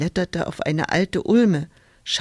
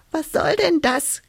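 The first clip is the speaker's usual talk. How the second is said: much higher, squeaky and cross; squeaky annoyed hedgehog; 10 semitones higher than usual. A narrator's reading. Hedgehog disturbed in the leaves, high annoyed squeak. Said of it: "quietschte da ein Igel ärgerlich".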